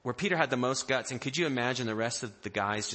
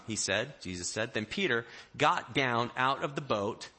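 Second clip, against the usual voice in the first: usual speaking voice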